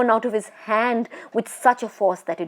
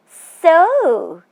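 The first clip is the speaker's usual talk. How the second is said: high, eerie teasing spirit voice; a tall witch toying with her prey; ghost woman; 8 semitones higher, 8 dB louder than usual. Acted. Ghost woman teasing the frightened Bishan Singh, eerie and high.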